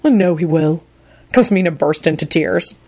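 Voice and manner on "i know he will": resigned, understated gloom; subdued, defeated admission; glum. A narrator's reading. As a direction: concede it dejectedly. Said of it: "Neville responded glumly".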